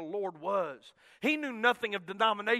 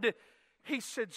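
ordinary talk, nothing marked

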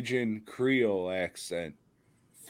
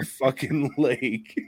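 ordinary talk

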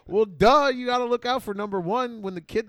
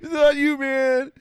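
hammed-up sob, higher and louder; mock crying; 8 semitones higher, 6 dB louder, slower than usual. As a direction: fake a tearful breakdown. Mock breakdown after the chant.

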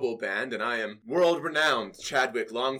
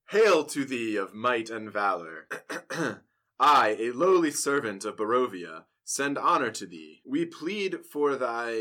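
put-on loud, grand old-fashioned letter reading; formal letter read; 6 dB louder than usual. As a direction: declaim the plea like a herald. Reads the letter aloud.

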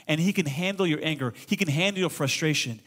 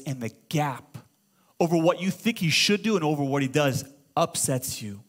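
usual speaking voice

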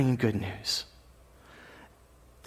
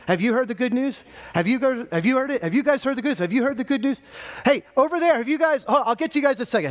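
hurried, high calling to one group after another; Kevin; 6 semitones higher than usual. Acted. Preacher rushing round to ask everyone about good news, frantic calling. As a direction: call out eagerly, pitched well up. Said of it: "imagine if Kevin was just going out there to preach".

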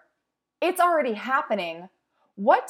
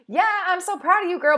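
fake sweet praise; 7 semitones higher, 4 dB louder than usual.

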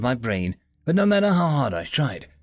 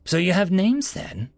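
chirpy; 7 semitones higher than usual. A narrator's reading. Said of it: "Suma chirped".

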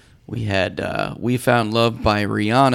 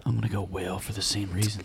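softer, deeper sexy voice; low sexy voice; 3 semitones lower, 11 dB quieter than usual. Shown as a demonstration. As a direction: lean into the sultry tone. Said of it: "Sexy voice".

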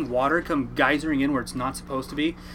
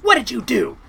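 imitated accusing question, higher and louder; accusing mom; 9 semitones higher, 8 dB louder than usual. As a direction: play the suspicious mother. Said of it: "She goes".

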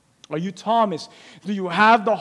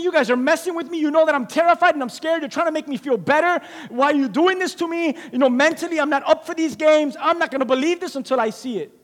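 higher, agitated doubter; frightened doubting Thomas; 8 semitones higher than usual. Retold or imitated. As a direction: argue out of fear and hurt.

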